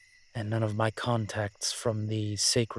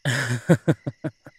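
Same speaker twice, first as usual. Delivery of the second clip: laugh; 3 semitones higher, 7 dB louder than usual. Acted. Amused at the listener's bravado.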